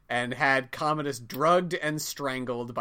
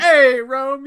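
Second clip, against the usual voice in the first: higher, louder call-out; 10 semitones higher, 13 dB louder than usual. Real, not acted. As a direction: call out loudly to Rome. Loud call to Rome.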